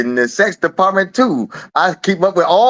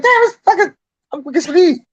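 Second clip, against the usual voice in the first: mock startled reply; 13 semitones higher, faster than usual. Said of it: "I'm like".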